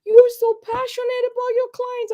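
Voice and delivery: high, squeaky mock-tearful wail; very high, loud blubbering imitation; bawling. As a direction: retell it in an exaggerated weepy voice. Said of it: "I was bawling".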